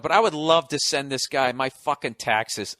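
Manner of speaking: ordinary talk, nothing marked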